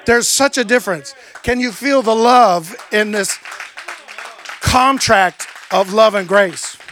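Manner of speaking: loud, slow, impassioned sermon delivery; rising sermon fervor; preaching. Acted. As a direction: lean into every word like a pulpit preacher. Preacher opening with full force.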